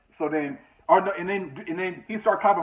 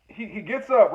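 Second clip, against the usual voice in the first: normal talking voice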